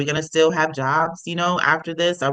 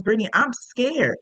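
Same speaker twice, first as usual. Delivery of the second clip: higher-pitched, mild imitation of a scared client; retold fear; 8 semitones higher than usual. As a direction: retell her scared plea, pitch lifted. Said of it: "But she's scared".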